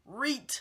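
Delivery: "read" stretched out with a rising pitch and a crisp final t, like "reeet"; "read" said long and swooping up, ending on a hard t; emphatic slang "read". Shown as a demonstration. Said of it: "Not read, read".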